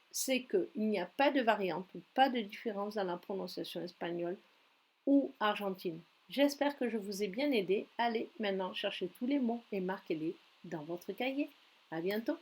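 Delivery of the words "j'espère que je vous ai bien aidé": ordinary talk, nothing marked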